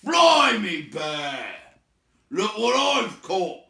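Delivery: high, loud troll yell; triumphant troll shout. Acted. William shouting to the others that he caught something. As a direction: bellow the catch to the others. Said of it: "grabbed Bilbo by the neck".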